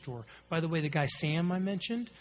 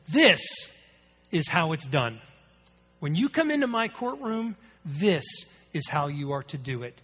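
stern judge; 9 dB louder than usual. Retold or imitated. Judge's stern courtroom lecture voiced.

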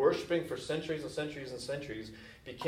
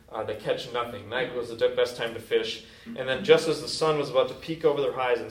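ordinary talk, nothing marked

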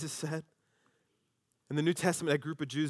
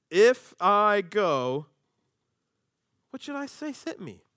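very slow, halting, then high; slow hesitant question; 6 semitones higher, 9 dB louder, slower than usual. Acted. Moses stalling, asking what to say, very slow. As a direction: drag out the doubt.

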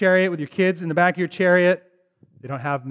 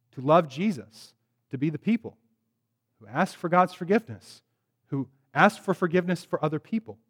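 ordinary talk, nothing marked

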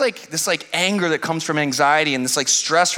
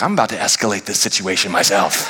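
mock threat; 8 semitones lower than usual. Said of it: "I'm like".

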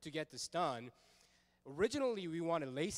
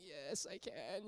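soft, slow pretend whimper; imitated pained reply, drawn out quietly; mock tearful; 2 semitones higher, 7 dB quieter, slower than usual. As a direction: answer weakly, imitating someone in tears. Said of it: "she's, like, crying".

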